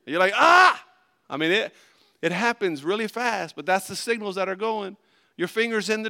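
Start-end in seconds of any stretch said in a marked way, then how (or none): 0.3-0.8 sharp high yelp of pain, much louder; pained yell. Retold or imitated. Preacher acts out a slammed finger, loud pained yelp.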